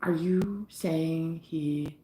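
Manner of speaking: slow, hushed disbelief; stunned realization. Retold or imitated. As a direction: let it sink in, barely voiced. Stunned realization in retold call.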